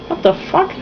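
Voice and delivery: baffled. Real, not acted. Reader reacts to the line.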